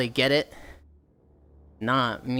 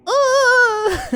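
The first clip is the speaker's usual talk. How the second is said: much higher, loud sudden "Oh!"; startled burst; bright squeal of surprise; surprised yelp; 6 dB louder than usual. Real, not acted. Sudden reaction.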